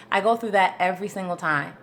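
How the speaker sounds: ordinary delivery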